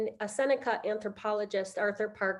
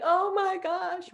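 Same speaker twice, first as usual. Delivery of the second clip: retold crying; 8 semitones higher, much slower than usual. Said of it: "right, crying".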